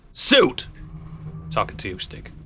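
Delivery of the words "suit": calling out. Acted. Calling out to the Suit.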